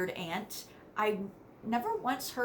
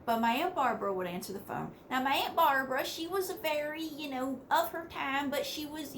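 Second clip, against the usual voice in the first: ordinary talk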